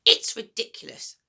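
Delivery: put-on high, loud, bossy old woman; a mean grandma giving orders, high and loud; grumpy grandma voice. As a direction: nag the boy like a sour old granny. Grandma scolds.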